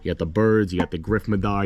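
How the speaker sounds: usual speaking voice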